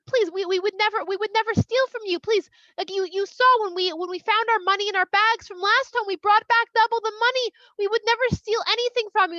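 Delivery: high, urgent acted plea; desperate pleading. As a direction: plead innocence, stumbling over the words. Brothers plead their innocence.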